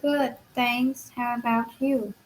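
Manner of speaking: usual speaking voice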